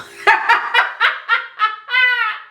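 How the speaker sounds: high, rapid run of giggles; laughing. Real, not acted. Host amused by mom's name for sniffing, laughing. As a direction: crack up at your own joke. Laughing at own soup joke.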